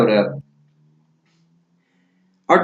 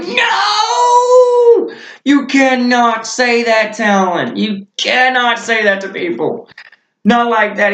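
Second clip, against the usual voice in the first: high, loud reenacted cry of protest; mock-horrified yell easing into high-pitched reproach; horrified retold scolding; 12 semitones higher, 4 dB louder, slower than usual. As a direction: start on a long wailed shout, then scold slowly. Reenacts his horrified scolding of Talon.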